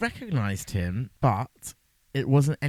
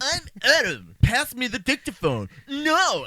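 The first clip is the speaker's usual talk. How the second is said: higher, louder, hammed-up demands; mock angry; 9 semitones higher, 6 dB louder, slower than usual. Mocking the two prisoners' bickering, exaggerated angry shout. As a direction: snap it back with swooping outrage. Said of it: "why are you so angry at each other?".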